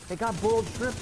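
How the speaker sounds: high, loud, cheery announcement; goofy prop guy. Retold or imitated. Prop guy pulling up in his van, high loud cheery offer. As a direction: sell the boiled shrimp like great news.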